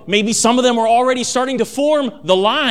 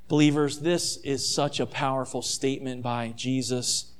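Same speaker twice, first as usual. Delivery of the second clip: usual speaking voice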